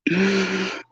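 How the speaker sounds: high, tailing-off laughter; real tickled laugh; high giggle. Real, not acted. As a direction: leftover giggles after a laughing fit. Still laughing at punchline.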